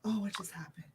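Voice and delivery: soft, breath-only whisper; whispered aside. Real, not acted. Guest wondering what just happened, whispered aside. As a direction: whisper it to yourself, puzzled. Puzzled aside during the stream.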